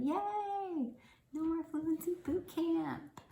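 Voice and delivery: high drawn-out "yay" cheer. Acted. Mock cheer for last video.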